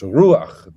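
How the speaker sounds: "ruach" with a loud, long U and short final A; stress on the U. Shown as a demonstration. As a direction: punch the U of "ruach", keep the A short. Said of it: "The accent is on the U".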